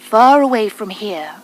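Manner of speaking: ordinary delivery, nothing marked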